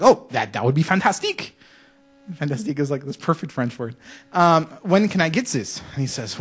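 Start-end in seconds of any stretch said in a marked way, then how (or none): 0.0-1.5 mock-French delight, rising in pitch; hammed-up French accent. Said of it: "do this in a French accent". 4.8-5.8 higher-pitched mock-French question; hammed-up French accent. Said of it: "do this in a French accent".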